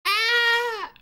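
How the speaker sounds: sudden high-pitched scream; high shriek. Acted. Reading the comic, he lets out a sudden high shriek. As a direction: shriek in fright.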